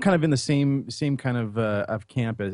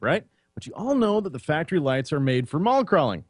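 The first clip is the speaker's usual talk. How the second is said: upbeat sponsor read, a little higher; 5 semitones higher than usual. Acted. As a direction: sell the light bars like a radio spot. Reads a sponsor ad.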